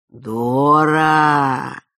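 slow, loud growled name; tender growl. A narrator's reading. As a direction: call a pet's name growling fondly. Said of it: "ласково прорычал он".

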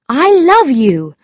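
earnest storybook suitor; Lord Struve's declaration. A narrator's reading. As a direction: declare it firmly as the man in the story.

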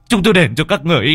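begging at the top of the voice; desperate begging. Acted. Husband begs the ghosts, rising to panic. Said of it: "thì họ mới van xin".

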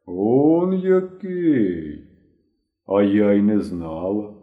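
very drawn-out, swooping first words; long stretched vowels; slow reproach. A narrator's reading. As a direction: say it slowly, letting the disappointment sink in. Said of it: "повільно промовила Агріпіна Тихонівна".

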